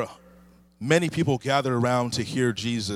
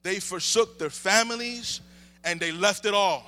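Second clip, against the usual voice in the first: higher, lifted preaching voice; 9 semitones higher than usual. Acted. Pastor preaching to the church, lifted and higher. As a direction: preach it out to the congregation.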